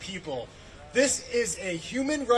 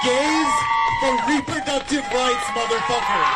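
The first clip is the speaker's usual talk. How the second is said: loud, high rally yell; shouted slogan; 4 semitones higher, 11 dB louder than usual. Retold or imitated. Shouting her slogan from the rainbow Cadillac, loud. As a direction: belt the protest slogan out. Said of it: "and I said".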